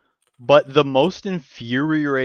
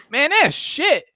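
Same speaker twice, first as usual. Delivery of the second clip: high, put-on voice of a disgusted friend; disgusted buddy; 14 semitones higher, 4 dB louder, slower than usual. Buddy realizes the splatter is shit, high and disgusted.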